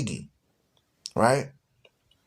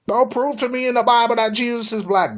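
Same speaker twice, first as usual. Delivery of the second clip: high-pitched imitation of an objector; mock protest; 8 semitones higher, 3 dB louder than usual. Mimicking someone who rejects the Bible proof, protesting. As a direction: mimic an indignant sceptic, pitched up.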